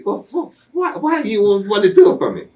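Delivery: squeaky, stammering Dusty impression; imitating Dusty. Wrestler imitates Dusty asking why, high stammering mock voice. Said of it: "and he asked me".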